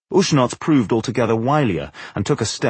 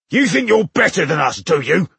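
high, raised orc bellow; belligerent shout; 8 semitones higher, 4 dB louder than usual. A narrator's reading. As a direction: shout it as a brutish challenge. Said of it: "he shouted belligerently".

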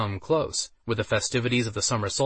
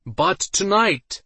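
showman announcer; 9 semitones higher, 5 dB louder, much slower than usual. A narrator's reading. Announcer continues speech.

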